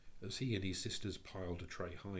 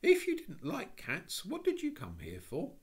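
kindly; 13 semitones higher, 6 dB louder than usual. Acted. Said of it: "said Jane kindly and softly".

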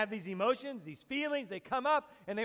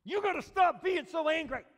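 louder, pointed scolding; scolding parent; 8 semitones higher, 8 dB louder than usual. Retold or imitated. Parent scolding an angry child, pointed. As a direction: wag a finger at the child. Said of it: "And they say it like that".

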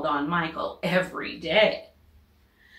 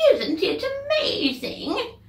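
put-on old lady; 13 semitones higher, slower than usual. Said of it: "An old lady from Beacon Hill said".

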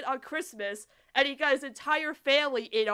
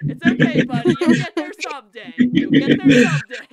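loud words breaking up with laughter; real, breathless giggling through the words; laughing consolation; 2 semitones higher, 10 dB louder than usual. Real, not acted. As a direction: console him while cracking up.

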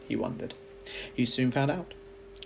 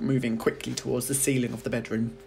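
usual speaking voice

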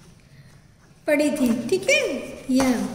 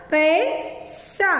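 very slow, drawn-out पैसा; slowly blending letters; 3 semitones higher, much slower than usual. Shown as a demonstration. Teacher sounds out पैसा slowly for children. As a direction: stretch पैसा out syllable by syllable. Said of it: "प पर आई की मात्रा".

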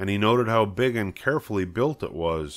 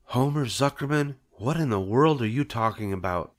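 higher, incredulous wife voice; bewildered wife; 4 semitones higher than usual. Acted. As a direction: demand what he means.

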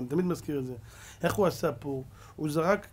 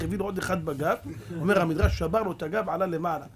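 normal talking voice

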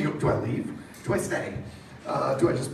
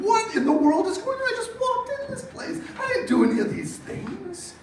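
high, laughing mock bewilderment; bewildered; 12 semitones higher than usual. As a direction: play baffled and caught off guard. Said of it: "and I'm thinking".

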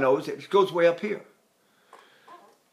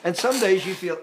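normal talking voice

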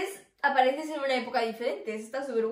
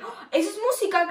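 retold surprise; 7 semitones higher, faster than usual. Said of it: "y dije".

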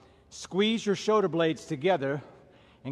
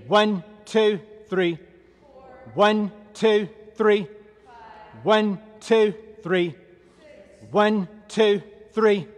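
raised, high steady count; drill cadence; 4 semitones higher, 5 dB louder, much slower than usual. Real, not acted. Trainer counting stationary lunges for class, called cadence. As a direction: call each number out in even rhythm.

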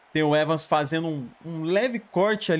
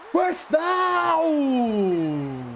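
excited shout; 9 semitones higher than usual. Real, not acted. Shouting a first down.

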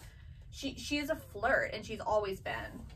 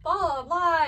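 high, louder, hammed-up boastful little girl; mock Juliet bragging; 7 semitones higher, 5 dB louder than usual. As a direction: gush it like a smug little sister showing off. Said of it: "listening to Juliet, like".

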